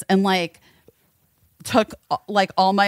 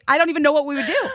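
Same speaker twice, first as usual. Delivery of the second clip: laughing near tears; 5 semitones higher, much faster than usual. Real, not acted. Imagining daughter's wrath.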